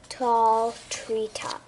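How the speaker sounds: usual speaking voice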